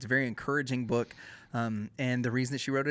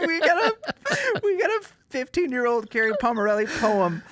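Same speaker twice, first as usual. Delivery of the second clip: laughing excitedly; 11 semitones higher, 6 dB louder than usual. Real, not acted. Excited recap through laughter.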